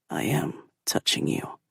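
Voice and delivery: soft, playful low whisper; silky soft. A narrator's reading. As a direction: tease her in a silky whisper. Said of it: "His voice is silky soft".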